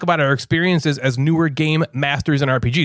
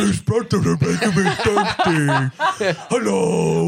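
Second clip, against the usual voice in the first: slow, hammed-up goofy guy voice; mock guy voice through laughter; put-on man voice; much slower than usual. Shown as a demonstration. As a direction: stretch it out in a silly forced voice. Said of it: "That should be the character voice".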